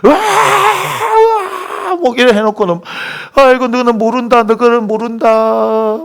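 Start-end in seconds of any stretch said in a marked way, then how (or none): 0.0-1.9 long, high imitated bawling; very drawn-out fake funeral howl; mock sobbing wail. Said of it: "한 시간, 두 시간씩 안 울어요". 3.3-6.0 high, slow keening; mock tearful lament. Mourner wails that others don't understand, mock keening. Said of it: "눈물을 막 흘리시면서 대성통곡".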